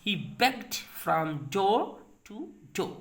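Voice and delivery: very slow, deliberate model sentence; slow model reading. Shown as a demonstration. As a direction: read it word by word, very slowly. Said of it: "जब आप ऐसे बोलते हैं".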